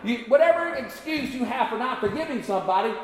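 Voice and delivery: high, pressing sermon delivery; forceful preaching. Real, not acted. Preacher challenging excuses for not forgiving, preaching forcefully. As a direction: drive the challenge home to the congregation.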